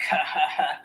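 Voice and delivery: a lightly done deep chuckle; imitated deep laugh. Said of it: "like that".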